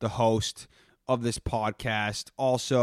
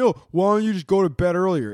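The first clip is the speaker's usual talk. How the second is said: mocking advice; 6 semitones higher, 7 dB louder, faster than usual. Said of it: "Everybody's telling me".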